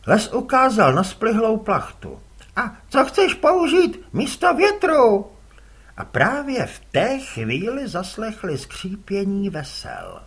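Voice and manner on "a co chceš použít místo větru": put-on much higher, louder boy's voice; a boy asking his dad, puzzled; young boy's voice. Les asks his father about the wind, boyish voice.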